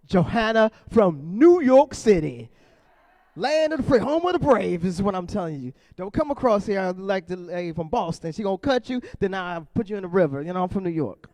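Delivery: tough New York hype. Pretend. Comic to Johanna from New York, loud brash hype. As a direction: puff her up like a proud New Yorker. Hypes the New Yorker audience member.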